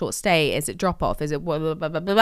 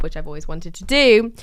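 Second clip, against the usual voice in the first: ordinary talk, nothing marked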